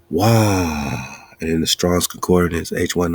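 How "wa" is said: sharp gasp in, then a forceful breathy Wa out. Shown as a demonstration. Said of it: "the fifth and the sixth letter".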